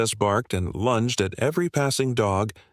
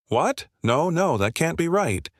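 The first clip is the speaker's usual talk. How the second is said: higher-pitched, mildly acted disbelief; 7 semitones higher than usual. A narrator's reading. Karen protesting to the officers who blame her dog, in higher-pitched disbelief.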